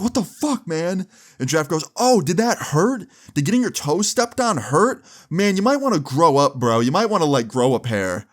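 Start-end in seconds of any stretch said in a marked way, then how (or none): 0.0-1.1 high-pitched indignant outburst; outraged disbelief. Retold or imitated. Zach falls over after the stomp, indignant and high. 1.9-8.3 high, sarcastic mock concern; mocking payback. Jeff turns Zach's insults back on him, mockingly. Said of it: "playing, like, the Uno reverse card on him".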